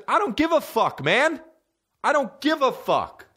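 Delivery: very high-pitched fed-up outburst; retold exasperation. Comic retelling reply to buddy, high exasperated protest.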